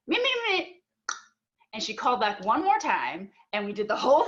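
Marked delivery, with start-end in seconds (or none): 0.1-0.8 mocking whine. Mocking mom's self-focus.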